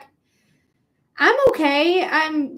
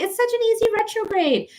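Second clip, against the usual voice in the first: mocking; 11 semitones higher than usual. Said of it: "everyone's like".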